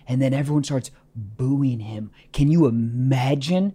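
whispered, slow and low; soft, slow whisper of disbelief; hushed aside. Real, not acted. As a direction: lean in and breathe it. Dramatic hush for the punchline.

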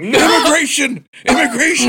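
pranking the dishwashers with a fake raid shout; retold raid shout.

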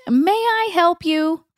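high, slow mock-polite question; a hint of sarcastic big sister; sarcastic ask. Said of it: "prompting D J to ask".

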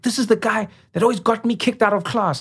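high, put-on woman's voice; animated mimicry of an old classmate; imitated schoolmate. Schoolmate introduces him to her family.

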